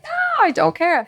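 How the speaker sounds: high, airy brush-off; mock breezy dismissal. Brushing off family's worries, high and airy. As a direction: toss it off in a high voice. Retelling refusing to be nervous.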